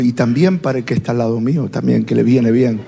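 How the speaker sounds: low, quick, lightly acted aside; churchgoer's aside. Churchgoer applies sermon to the neighbour, lightly acted quick low aside. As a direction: toss it off low and fast, as to oneself.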